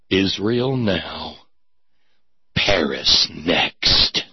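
low, slow, grim announcer read; ominous slogan voice. Acted. Reading the poster slogan as a grim low trailer voice. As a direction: intone it like a menacing movie trailer.

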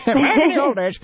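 high, put-on old-timer voice, jokey; mock old-timer. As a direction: echo it in a comic high-pitched voice. Mock old-timer echo of own phrase.

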